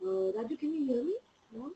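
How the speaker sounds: very faint, voice too low; barely audible. Real, not acted. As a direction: speak barely above nothing. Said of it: "Your voice is very low".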